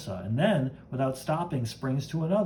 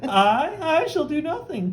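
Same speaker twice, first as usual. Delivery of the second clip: high voice; 13 semitones higher, 4 dB louder, slower than usual. Acted. Bruce refusing, mock-heroic.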